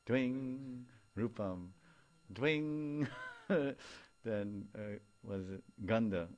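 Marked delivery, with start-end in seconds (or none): 0.1-0.8 playful twing. Shown as a demonstration. Twang sound effect per sense. 2.3-3.1 a high, louder twing sound; playful twing. Shown as a demonstration. Twang sound effect per sense.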